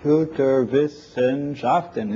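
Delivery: "Computerwissenschaften" slowly, each piece set apart; syllable by syllable. Shown as a demonstration. Lecturer reading the hyphenation result, "Computerwissenschaften" slowly in pieces. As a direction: break "Computerwissenschaften" into its separate syllables.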